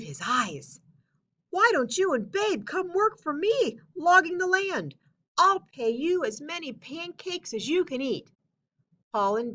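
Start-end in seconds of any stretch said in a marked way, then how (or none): 1.5-8.3 high, bright job offer; eager Bill. Acted. Bill offering Paul and Babe work, high and eager. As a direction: sell the deal with pancakes.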